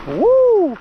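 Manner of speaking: loud high squeaky whoop; helium voice. Real, not acted. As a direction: yell it out, thrilled and squeaky. Jumping on double motor.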